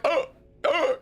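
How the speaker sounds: high, startled mock yelps; acting out being caught off guard; re-enacted startled yelp. Storyteller rushed by a stranger's hug, startled yelps. Acts out being suddenly hugged.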